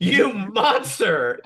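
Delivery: loud, high, drawn-out outrage; hammed-up horrified exclamation; Tahani. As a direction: cry it out slowly, scandalised. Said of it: "Tahani goes".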